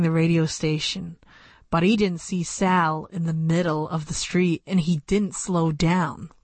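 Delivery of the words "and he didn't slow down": ordinary delivery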